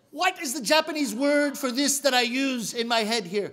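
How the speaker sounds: ordinary delivery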